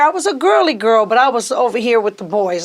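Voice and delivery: ordinary delivery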